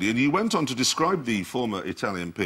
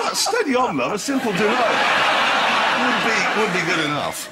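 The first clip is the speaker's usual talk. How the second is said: high, laughing put-on toff voice; mock posh reply; 9 semitones higher, 4 dB louder than usual. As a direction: play it as a camp, airy brush-off. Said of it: "So I was going".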